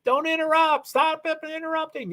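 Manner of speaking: high-pitched, exasperated scolding; mock annoyed scolding. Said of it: "And I'd be like".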